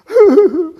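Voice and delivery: loud, high fake wail; mock sobbing. Human upset on a typical day, loud mock sobs. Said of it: "We get upset".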